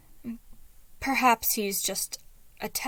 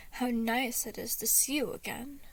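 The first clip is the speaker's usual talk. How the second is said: usual speaking voice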